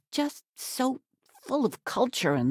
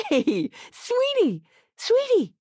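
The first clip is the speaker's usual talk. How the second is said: calling out; 4 dB louder, slower than usual. Acted. Mother calling out to child.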